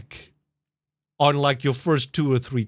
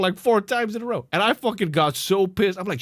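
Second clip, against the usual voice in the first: normal talking voice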